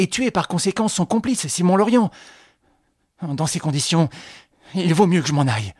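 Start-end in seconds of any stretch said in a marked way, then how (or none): none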